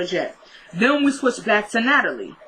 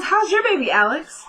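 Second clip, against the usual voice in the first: high-pitched mock-innocent needling; petty; 7 semitones higher than usual. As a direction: imitate a petty dig, pitched way up. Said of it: "She's being petty".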